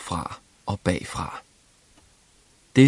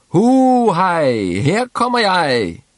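shouting gleefully; 10 semitones higher, 5 dB louder, slower than usual. A narrator's reading. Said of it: "råbte Klods Hans".

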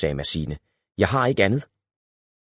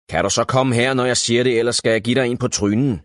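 louder, fast bully threat; menacing threat; 4 semitones higher, 5 dB louder, faster than usual. A narrator's reading. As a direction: threaten the piglet hard. (truende, vred)

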